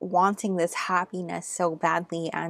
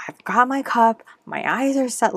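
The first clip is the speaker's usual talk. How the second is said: higher and louder, fixed on the goal; determined Alice; 6 semitones higher, 6 dB louder than usual. Acted. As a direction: voice Alice locked onto her cup. Voicing focused Alice on card.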